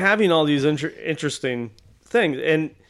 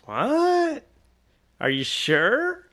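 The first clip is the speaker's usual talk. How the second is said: high rising "what", then slow doubt; mock disbelief; 10 semitones higher, much slower than usual. Kingpin cosplayer doubting the artist, drawn-out disbelief. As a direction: stretch it out incredulously.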